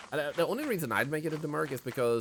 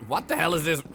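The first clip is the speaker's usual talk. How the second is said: surprised; 9 semitones higher, 7 dB louder than usual. Real, not acted. Confused by what appears.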